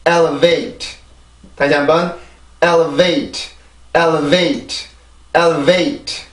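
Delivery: elevate built up L, 어, wait, then whole; syllable by syllable, stress on EL; teacher modelling elevate again; slow pronunciation drill. Shown as a demonstration. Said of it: "하나하나 다시 발음을 해볼게요".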